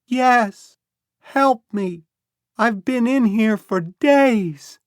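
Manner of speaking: put-on high, loud, slow child's cry; a small boy yelling back for help; boy calling back. A narrator's reading. Lost boy answers Becky, calling loud and high. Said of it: "he called back".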